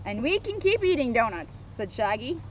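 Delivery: measured, lively storybook narration; children's story reading. A narrator's reading. Officer reads Scooby book to kids, storybook narration. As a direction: read it out clearly for small listeners.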